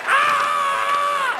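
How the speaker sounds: piercingly high held shriek; hammed-up labor scream; mock pain scream. Comic imitating his wife's next contraction, shrieking in mock pain. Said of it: "Then she's like".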